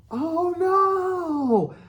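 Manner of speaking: high, loud cry of alarm; panicked shout. Retold or imitated. Narrator cries out as the bull charges, panicked shout. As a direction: yelp it as the bull closes in. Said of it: "I shout".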